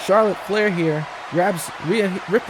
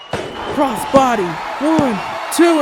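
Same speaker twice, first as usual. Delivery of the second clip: excited pin count; 5 semitones higher, 3 dB louder, much slower than usual. Real, not acted. Commentator counting the pin for viewers, excited rising count. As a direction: count pitched high and loud, rising on two.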